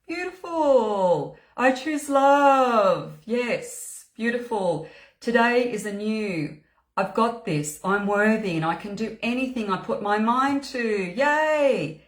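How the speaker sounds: high, drawn-out delight; delighted cheering. Real, not acted. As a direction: cheer on each affirmation, stretching the words. Cheering on affirmations shared in the comments.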